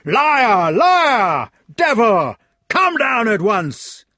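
very loud, high enraged yelling; purple-faced knight shouting; furious shout. Acted. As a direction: roar insults in fury. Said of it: "grew quite purple in the face and shouted".

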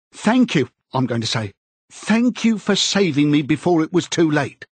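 high-pitched, fervent confession; earnest, grateful Parsons. Acted.

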